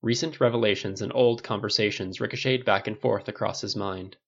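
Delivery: ordinary delivery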